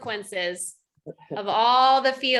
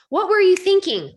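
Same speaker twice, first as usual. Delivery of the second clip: scolding self; 7 semitones higher, 8 dB louder than usual. Retold or imitated. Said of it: "I was like".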